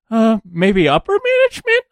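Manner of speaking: high, tentative upward revision; comic high-pitched recruiter's hesitation; hesitant recruiter. Acted. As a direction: squeak out an impressed second guess. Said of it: "and they go".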